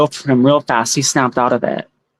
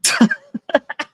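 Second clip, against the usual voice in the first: witch cackle; 11 semitones higher, 3 dB quieter than usual. Shown as a demonstration. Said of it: "does it sound like a wicked witch".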